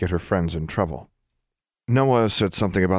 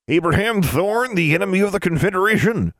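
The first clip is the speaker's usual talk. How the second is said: put-on old granny voice; 13 semitones higher, 4 dB louder than usual. A narrator's reading. Granny names father.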